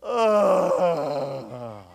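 long groaning sigh, falling away; mock groaning exhale. Mocking a colleague's definition of a Hebrew word, long hammed-up groan. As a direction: heave out an exaggerated falling groan. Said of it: "Here's the Hebrew word for repent".

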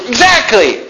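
high, eager mock-excited agreement; all excited. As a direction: ham up the Jehovah's Witness jumping at it. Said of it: "He was all excited".

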